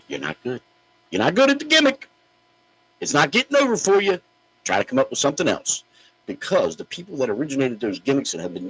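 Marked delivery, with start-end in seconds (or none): 0.1-0.6 unvoiced whisper of "You're not good"; whispered jab. Real, not acted. Host quietly twisting the knife in a whisper. Whispered aside after Ouch. 1.1-4.2 much higher, louder mocking taunt; mocking sing-song taunt. Real, not acted. Host taunting gimmick thieves in a high mocking voice.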